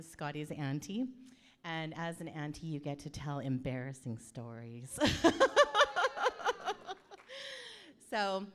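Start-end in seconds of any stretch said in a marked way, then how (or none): 5.0-8.0 long, high, loud peal of laughter; real cackle. Real, not acted. Auntie promises embarrassing stories, long high cackle. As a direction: burst into a high rattling laugh.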